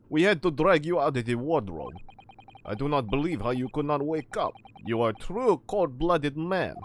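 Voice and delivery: loud Russian sailor voice; Russian accent. Acted. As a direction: scold him in a forceful Russian accent. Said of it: "it's the Russian, other Russian sailor".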